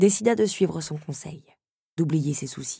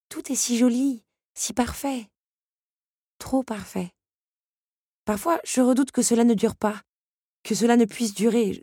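lightly higher Belle voice, uneasy; worried young Belle; 6 semitones higher than usual. Acted. Belle confiding her fears to Henri, lightly higher and uneasy.

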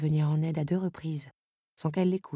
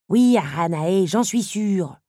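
annoyed; 4 semitones higher, 7 dB louder, slower than usual. A narrator's reading. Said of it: "s'agaça le scarabée".

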